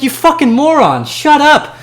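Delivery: high, loud furious yelling; angry outburst. Real, not acted. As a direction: let rip at the haters. Ranting at commenters.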